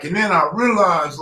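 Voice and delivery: usual speaking voice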